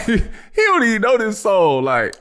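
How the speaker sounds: high, loud, laughing mockery; mocking laugh. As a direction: laugh at him while imitating the crowd.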